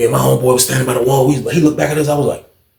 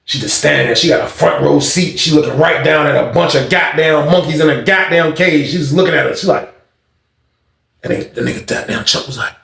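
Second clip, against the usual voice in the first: usual speaking voice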